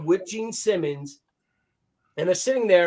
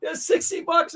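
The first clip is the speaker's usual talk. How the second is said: higher-pitched indignant protest; mock indignant; 10 semitones higher than usual. [name] reminding Gene of the sixty, mock indignant. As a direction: keep the mock outrage going, pitched up. [name] refusing more cash.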